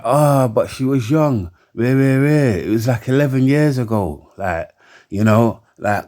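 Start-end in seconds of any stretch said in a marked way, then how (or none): 0.0-4.3 mocking excuse. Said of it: "swing it like".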